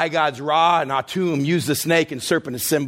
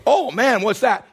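startled; 7 semitones higher than usual. Acted. Moses startled by snake.

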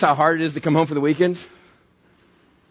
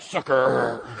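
smug taunt; 4 semitones lower, 4 dB quieter than usual. Acted. Brawler sneers at his knocked-out victim, soft smug taunt. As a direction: lower and softer sneer. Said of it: "he's like".

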